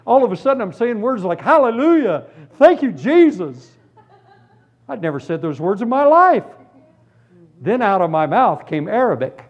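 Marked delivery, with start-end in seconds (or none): 1.4-3.7 joyful praise. Retold or imitated. New convert bursting out to Jesus in joyful praise. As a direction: burst out in joy.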